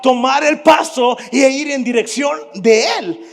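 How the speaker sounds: loud, high, driving sermon cadence; full-force preaching. Acted. Preacher urging the congregation to trust God's word, shouting. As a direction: preach it to the rafters.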